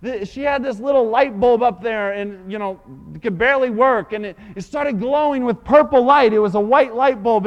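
loud, building sermon climax; full-force preaching. Acted. Preacher describing the shack filled with glory, full-force preaching. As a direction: preach it out with fire.

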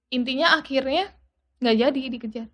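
ordinary delivery

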